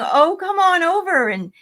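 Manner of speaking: hearty mock invitation; jovial brother inviting. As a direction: call her over like a brother pouring cocktails. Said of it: "He's saying".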